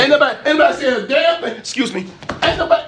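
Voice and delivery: high, fast indignant denial; indignant outburst. Real, not acted. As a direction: snap back that nobody said it. Grandma insult, indignant denial.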